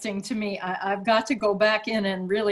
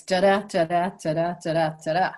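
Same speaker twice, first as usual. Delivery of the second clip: da-DA da-DA, weak then strong beats; sing-song "da-da" rhythm stressing the second syllable; 3 semitones lower, faster than usual. Shown as a demonstration. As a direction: tap out the iambic beat on "da-da". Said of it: "generally speaking".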